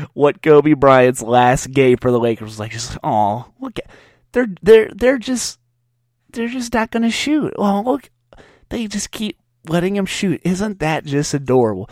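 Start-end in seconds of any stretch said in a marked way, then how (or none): 3.0-11.9 put-on much higher, doting baby-talk voice; mock cooing. Mocking Kobe's last game as if fans adore a child. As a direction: coo like watching a toddler play.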